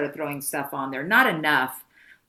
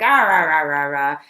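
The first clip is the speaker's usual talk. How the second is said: loud, wordless angry rant; hammed-up loud "rah rah" outburst; mock angry ranting; 9 dB louder than usual. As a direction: blurt out a burst of furious gibberish. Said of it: "much angrier than it was before".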